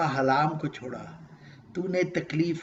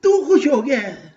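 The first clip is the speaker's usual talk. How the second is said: joyful higher, louder question; 7 semitones higher, 9 dB louder than usual. Acted. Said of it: "اللہ تعالیٰ کہتا ہے".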